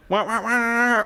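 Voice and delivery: high imitated chinchilla wail; silly high-pitched animal noise. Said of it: "Apparently that's what chinchillas sound like".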